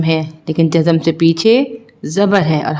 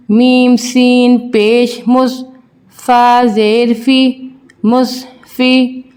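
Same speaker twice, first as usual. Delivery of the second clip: put-on drawn-out sing-song spelling drill for children; teacher slowly spelling out Arabic syllables for young pupils; slow letter-by-letter recitation; 4 semitones higher, slower than usual. Shown as a demonstration. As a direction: chant each letter and vowel slowly, as in a Qaida lesson.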